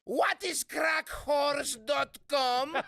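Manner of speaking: put-on high, slow yelling mom in a Greek accent. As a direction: shout it like a Greek mother. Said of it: "in her fucking Greek accent".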